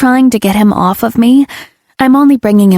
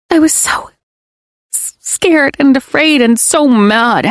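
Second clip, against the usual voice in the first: shaky confession; 6 semitones higher than usual. Acted. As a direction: stumble over scared. Confessing fear mid-rant.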